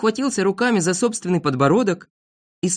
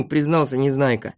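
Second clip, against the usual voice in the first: usual speaking voice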